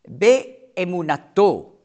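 hebräisches Wort langsam vorgesprochen; Hebrew word said slowly. Shown as a demonstration. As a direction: pronounce "Be'emunato" slowly for the listeners. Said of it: "das zweite Wort heißt".

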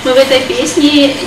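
usual speaking voice

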